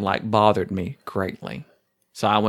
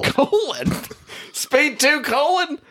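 high, far-off jeering voice; mocking voice; slower than usual. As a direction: squeak out the title in mock. Mocking the title colon.

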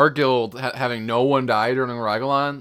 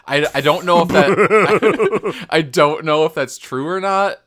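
laughing; 5 semitones higher, 6 dB louder, slower than usual. Real, not acted. Laughing at a silly rumour.

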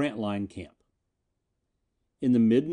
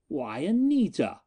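astonished; 6 semitones higher than usual. Acted. Miko's repeated astonishment.

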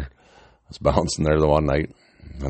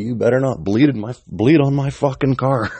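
raised, higher mock-angry yell; acted-out fatherly yell, climbing in pitch and volume; imitated screaming dad; 8 semitones higher, 4 dB louder than usual. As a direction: scold like a dad protective of his car. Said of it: "Dad's screaming at me".

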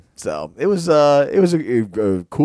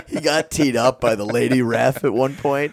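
usual speaking voice

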